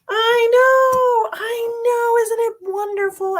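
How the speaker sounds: overacted squealing joy; mock gushing. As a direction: ham up the delight.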